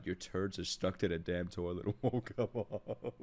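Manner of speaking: ordinary talk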